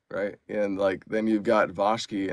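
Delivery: ordinary talk, nothing marked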